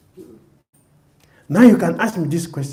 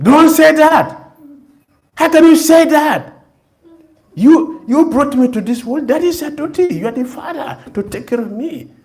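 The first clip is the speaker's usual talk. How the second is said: loud, much higher outraged protest; indignant child; 10 semitones higher, 8 dB louder than usual. Retold or imitated. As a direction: shout it back at the father. Child refusing father's karma.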